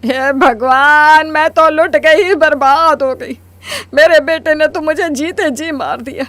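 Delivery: wailing lament. Acted. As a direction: wail like a mother betrayed by her son. Mother wails over son's marriage. (रोते हुए विलाप)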